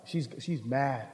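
barely voiced, low and slow; almost under your breath, dreading it; hushed aside. Confiding to the audience that she is mad, hushed and slow. Aside about his upset wife.

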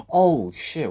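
high, loud gasp and cry; startled. Real, not acted. Player jumping at a game scare, startled cry. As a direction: jolt into a loud, high outburst.

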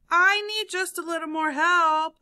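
loud, high appeal; calling out. A narrator's reading. Elephant calls to the watching crowd, calling out. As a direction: call it across to a crowd. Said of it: "Elephant called out to them".

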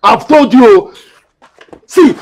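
high, loud threat; angry warning. Acted. Renewed threat in a quarrel.

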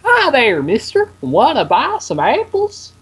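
high, sing-out little seller voice; young pony vendor. Acted. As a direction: pipe up as a tiny apple seller. Acts apple-selling filly in game.

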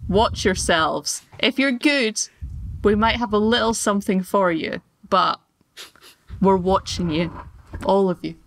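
low, slow threat; menacing bugbear. Acted. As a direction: loom over them slowly.

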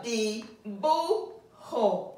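each of "di, bu, ho" set apart with a pause; syllable by syllable. Shown as a demonstration. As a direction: break "di, bu, ho" into three separate beats. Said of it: "So we have three syllables".

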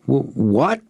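high, stuttered question in disbelief; amazed stammer. A narrator's reading. Buyer accused of not telling the truth, stammering amazed. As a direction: stumble on the first sound, astonished. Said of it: "I stammered, amazed".

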